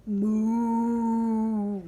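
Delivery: long sustained imitated moo; one drawn-out steady moo; bull mooing. A narrator's reading. Narrator voicing a bull from Brazil, long moo. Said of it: "and bull".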